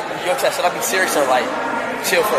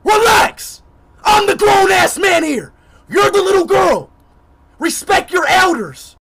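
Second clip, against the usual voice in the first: raised, higher scolding shout; indignant scolding; 4 semitones higher than usual. Real, not acted. Scolds game character, pulls rank.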